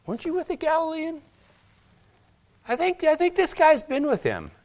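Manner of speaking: put-on high, fast, questioning voice of the servant girl; accusing servant. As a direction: accusingly point at Peter, high-pitched.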